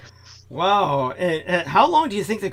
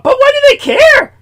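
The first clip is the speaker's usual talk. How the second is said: much higher and louder, exasperated; indignant yell; 10 semitones higher, 11 dB louder than usual. Real, not acted. Complaining about Ian's aunt, indignant yell. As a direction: shout your disbelief at the family. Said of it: "indignant outburst".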